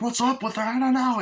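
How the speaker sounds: high imitated frightened voices; mock panic. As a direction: play two panicked friends. Acts panicking friends' exchange.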